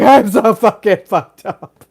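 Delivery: loud, slow wail of despair; hammed-up loud breakdown; mock despair. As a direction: overdo the self-pity. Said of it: "wanna be like".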